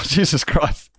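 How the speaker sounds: ordinary talk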